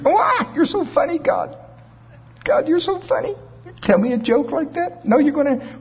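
high, squeaky put-on voice, mock amused; Sarah. Preacher as Sarah answering God's promise, high mock-amused voice. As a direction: act tickled, pitched far above your own voice. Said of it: "Sarah when she laughed".

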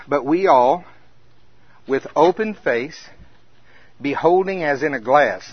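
slow, solemn scripture reading. Acted. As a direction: read it as holy text. Reads scripture aloud.

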